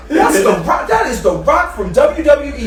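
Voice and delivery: high, loud, put-on astonishment; feigned amazement. Friends covering up in the theater, loud feigned amazement. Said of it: "we trying to play it off like".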